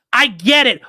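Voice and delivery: high, loud fed-up yell; exasperated shout. Real, not acted.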